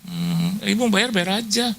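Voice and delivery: high, hurried pleading "Bu, Bu, jangan libatkan saya"; mock the woman backing out in a panic; panicky high voice. Imitating the donor refusing on the phone, high and panicky. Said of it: "Ya, jadi Bu yang itu".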